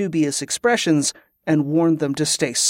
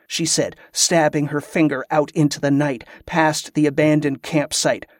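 ordinary delivery, nothing marked

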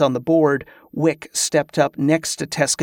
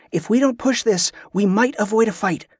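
higher-pitched cautioning; warning; 6 semitones higher than usual. A narrator's reading. Kerla urging her allies to avoid fighting, high warning. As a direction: caution them urgently. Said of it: "Kerla warned".

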